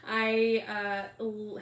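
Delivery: ordinary talk, nothing marked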